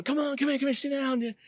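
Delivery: eager welcome. Retold or imitated. Charismatic boss inviting her to sit, eager and high. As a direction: beckon her in with bright enthusiasm.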